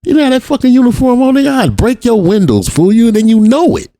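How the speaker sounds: high, heated challenge to the cop; a mock confrontation, pitch way up; angry retort. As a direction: square up and shout him down.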